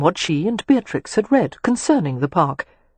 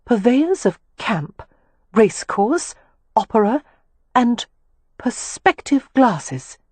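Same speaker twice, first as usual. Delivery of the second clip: slow, higher voice, item by item; reading aloud; 5 semitones higher, slower than usual. A narrator's reading. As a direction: read a sign out piece by piece, with pauses. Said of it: "she read aloud".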